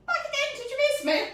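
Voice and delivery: high, bright hello; playful high greeting. Pretend. Baker greeting viewers on return, high playful. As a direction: pop back in cheekily.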